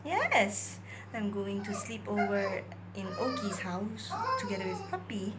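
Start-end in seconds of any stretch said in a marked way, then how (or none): 0.0-0.8 excited yes. Real, not acted. Answering whining dog excitedly.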